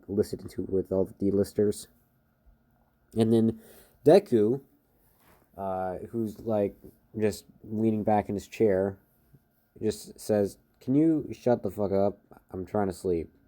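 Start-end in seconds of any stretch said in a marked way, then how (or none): none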